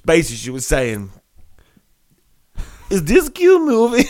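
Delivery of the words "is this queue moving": put-on high, loud, slow go at a Finnish accent; badly faked Finnish accent, pitched way up; attempted Finnish accent. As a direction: have a hammy try at a Finnish woman's accent. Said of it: "I'm not gonna do the accent. Well, I'll try".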